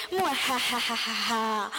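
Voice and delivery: slow, low evil laugh; hammed-up villain cackle.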